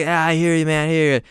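high agreeable rocker voice. Retold or imitated. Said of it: "Slash like".

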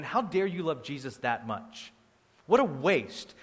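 ordinary talk

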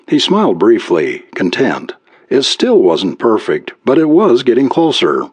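usual speaking voice

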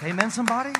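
high, loud rising call to the crowd; calling for amen. Real, not acted. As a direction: demand an answer from the room. Preacher calls for response.

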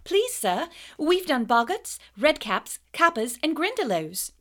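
high, loud, eager schoolgirl; eager Hermione. A narrator's reading. Hermione rushing to answer the teacher, high eager voice. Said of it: "said Hermione quickly".

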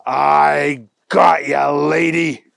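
slow, loud gotcha call; triumphant shout. Acted. Agent catches thief on video.

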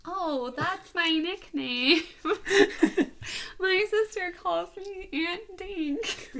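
high, slow words through real laughter; helpless giggling, drawn-out words; laughing hard. Real, not acted. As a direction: squeak it out while cracking up. Cracking up over her nickname.

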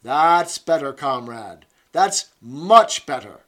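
loud, high, rousing encouragement; encouraging. Acted. Instructress urging the comrades to keep fit, loud and encouraging. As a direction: pump up the squad to keep fit. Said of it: "she added encouragingly".

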